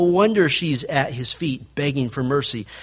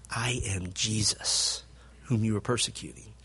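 Jesus answering; 6 semitones lower, 10 dB quieter than usual. Retold or imitated. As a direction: answer gently from far away as Jesus. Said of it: "You remember the answer?".

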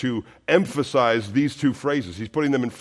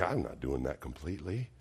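mock reluctant listener, softer and fast; muttered objection; 10 semitones lower, 15 dB quieter, faster than usual. Preacher voicing a reluctant listener, muttered quickly. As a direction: toss off a listener's stubborn refusal under the breath. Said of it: "you're like".

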